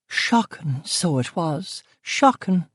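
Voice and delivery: hushed, high, sorrowful Belfast cook; hushed shock. Acted. Cook lamenting the killing to the detective, hushed.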